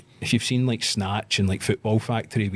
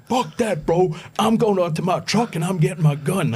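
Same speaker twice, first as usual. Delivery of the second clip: threatening gym guy; 10 semitones higher, 5 dB louder than usual. Retold or imitated. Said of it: "And he went".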